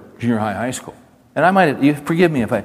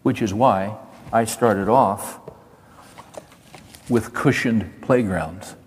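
slow and deliberate, each word weighed; slow, weighty; slower than usual. Acted. Pastor drives home his warning, slow and deliberate. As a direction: let the point sink in slowly. Slowing for emphasis.

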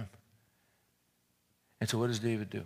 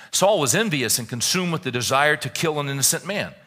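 loud, high, driving sermon reading; preaching at full force; 4 semitones higher, 10 dB louder than usual. Acted. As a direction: build it to a fiery climax, slowing at the end. Reads Wiersbe at full force.

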